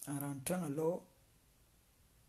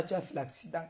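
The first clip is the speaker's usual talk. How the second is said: ordinary delivery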